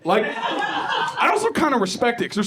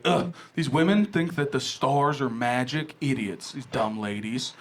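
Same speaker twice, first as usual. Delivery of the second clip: lower, softer sneering dude voice, dropping to a mutter; mocking straight dude; 5 semitones lower, 5 dB quieter than usual. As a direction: ape a smug guy scoffing at astrology. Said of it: "straight dudes' favorite thing to do".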